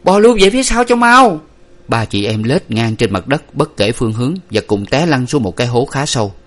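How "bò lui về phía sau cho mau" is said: high, urgent shouted order; panicked loud command; loud yell. A narrator's reading. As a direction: yell at your siblings to crawl back. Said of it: "la lớn lên".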